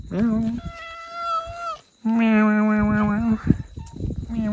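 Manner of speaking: high, repeated mewing meows; cat meows. Pretend. Owner meowing back and forth with the cats, high.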